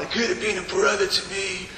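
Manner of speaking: just a hint of an Italian mobster accent. Preacher quoting the Godfather to his congregation, light Italian accent. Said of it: "shall I do an Italian accent?".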